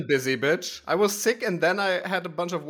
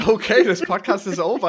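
mock outrage; 4 semitones higher, 7 dB louder than usual.

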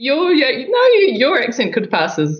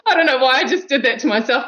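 high, fast, helpless giggly talk; laughing; 11 semitones higher, faster than usual. Real, not acted. Guest flustered by saying it herself, laughing. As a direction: laugh through the words. Laughing at her own pronunciation.

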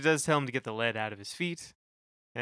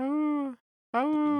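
high yelping oohs; dog noises; 14 semitones higher than usual. Retold or imitated. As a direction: yelp like a backup dog. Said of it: "make dog noises".

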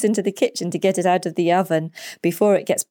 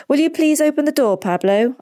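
raised, higher-pitched request; dad calling out; 9 semitones higher, 3 dB louder than usual. A narrator's reading. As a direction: call to your son across the room. Dad calls to son at lunch.

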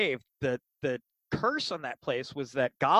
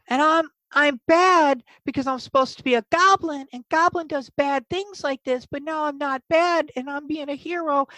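high, anguished little goblin voice; Boogers; 13 semitones higher, 4 dB louder than usual. Acted. Goblin pointing out the curse to friends, distraught goblin voice. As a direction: confess guilt as a distraught goblin. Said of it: "and he's like".

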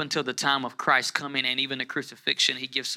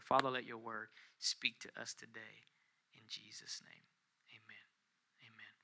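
very soft, low-pitched prayer; quiet prayer; 3 semitones lower, 16 dB quieter than usual. Real, not acted. Preacher praying for God's word to speak today, hushed. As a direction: pray gently, fading away to the amen.

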